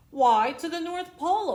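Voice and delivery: high, loud proud announcement; grand conductor. Acted. Conductor telling the boy, proud loud announcement.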